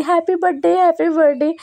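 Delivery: lightly voiced toddler wishing happy birthday; little girl voice. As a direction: chirp it like the toddler would. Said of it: "ऊपर वाली कह रही".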